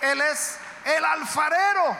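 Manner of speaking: high, loud, slow proclamation; preaching shout. Acted. Preacher proclaiming God the potter: slow, loud, high. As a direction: proclaim it to the back row.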